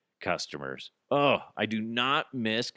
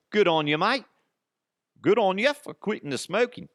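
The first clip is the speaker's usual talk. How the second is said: higher, louder mock New Zealand accent; put-on New Zealand accent; 8 semitones higher, 4 dB louder than usual. Host congratulating New Zealand on quitting smoking, put-on New Zealand accent. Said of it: "I need to stop with the accent".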